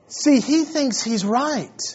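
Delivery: usual speaking voice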